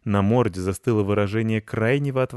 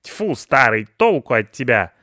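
loud, higher, exasperated grumbling; scolding; 4 semitones higher, 9 dB louder than usual. A narrator's reading. Pensioner cursing her useless husband, scolding. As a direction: berate him in disgust. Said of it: "ругалась Петровна".

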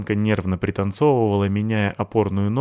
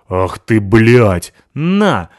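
loud, rising furious cry; furious shout; 9 dB louder, much slower than usual. Acted. Натаха получила ногой по лицу и орёт в ярости. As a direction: strike back in rage.

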